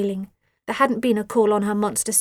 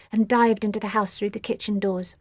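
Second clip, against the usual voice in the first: ordinary delivery, nothing marked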